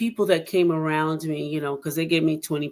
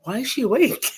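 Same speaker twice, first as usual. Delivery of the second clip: higher, laughing through the doctor's line; doctor quote with a laugh; 9 semitones higher, 5 dB louder than usual. Retold or imitated. Retelling the doctor's question to S1, laughing. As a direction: laugh while imitating the puzzled doctor. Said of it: "the doctor walks up and he goes".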